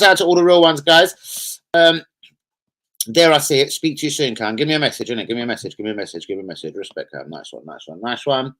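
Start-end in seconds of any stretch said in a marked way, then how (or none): none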